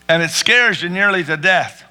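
ordinary talk, nothing marked

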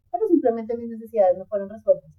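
ordinary talk

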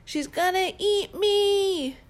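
high, slow panicked squeal; panicked warthog. Acted. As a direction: shriek it in a panic. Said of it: "cried the panicked warthog".

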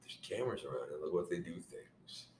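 very low, hushed teasing; soft, low insinuating aside; mock sly murmur. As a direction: drop it way down, like a creepy suggestion. Creepy teasing aside to co-host.

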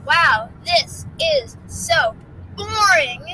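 slow, high, exaggerated griping; dragon's complaint. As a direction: drag out each word in mock complaint. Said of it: "the dragon's like".

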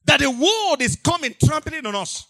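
loud, high anguished cry to the Lord; preacher's desperate plea to God; pleading prayer. Acted. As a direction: lament to God at full voice. Crying out to God in sermon.

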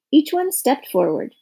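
plain reading voice

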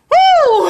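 high, loud celebratory woo; joyful whoop. Real, not acted. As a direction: whoop for yourself. Cheering for herself.

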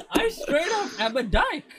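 high laughing outburst; squealing with laughter. Real, not acted. Bursts out laughing at own joke.

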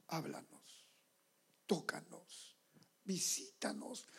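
hushed intimate prayer, breathy whisper. Retold or imitated. Pastor recalling his prayer, whispering. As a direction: pray it under your breath.

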